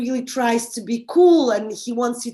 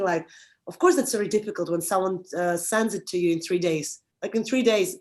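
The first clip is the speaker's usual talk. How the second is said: ordinary talk, nothing marked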